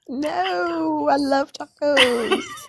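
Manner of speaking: high, slow, drawn-out delight; real laugh into a gleeful, stretched-out reply; amused, sing-out agreement. Real, not acted. Laughing agreement to playful question.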